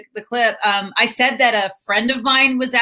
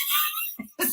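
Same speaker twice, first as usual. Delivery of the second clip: creature cackle; 13 dB quieter than usual. Retold or imitated. Cackle after precious impression.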